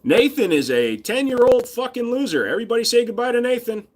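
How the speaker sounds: high-pitched jeering announcement; mocking send-off. Kicking a viewer off the stream, high mocking send-off. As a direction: jeer at them in a high, taunting voice.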